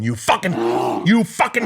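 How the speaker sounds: screaming at the pillow. Retold or imitated. Alone at night raging at his pillow, furious yell. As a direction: let the rage burst out at the pillow. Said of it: "screaming at the fucking pillow".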